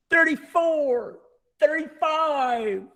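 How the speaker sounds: high, slow jeering count; bully pitcher counting. A narrator's reading. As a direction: gloat over each strikeout, drawn out. McNabb counting strikeouts, bully pitcher.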